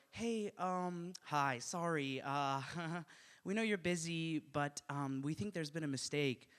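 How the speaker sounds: soft, hesitant acted parent; halting, apologetic small voice; timid apology. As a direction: broach it gingerly and politely. Parents timidly approach the nurse.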